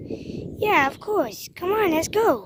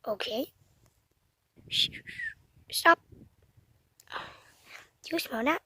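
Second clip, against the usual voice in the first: whisper; 11 dB quieter than usual. Pretend. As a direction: hush and sniff the air. Sneaking, hushing the other.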